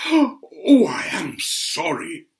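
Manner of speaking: hammed-up gasp, high then slow and whimpering; mock apology. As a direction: play the fake-innocent villain. Wrestler's mock apology to referee.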